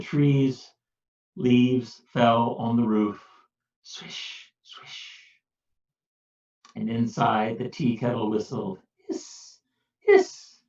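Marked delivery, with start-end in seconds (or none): 3.8-5.1 unvoiced whisper swish. A narrator's reading. Soft leaves now. 9.1-9.6 whispered kettle hiss. A narrator's reading. Kettle softly. 10.0-10.6 high whistling hiss; kettle hiss. A narrator's reading. Kettle sound.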